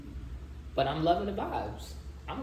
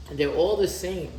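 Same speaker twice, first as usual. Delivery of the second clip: louder, higher insistence; emphatic; 5 semitones higher, 8 dB louder than usual. Real, not acted. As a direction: lean on it hard. Emphatic first take.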